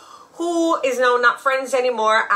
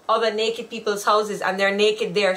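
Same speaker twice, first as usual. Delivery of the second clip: usual speaking voice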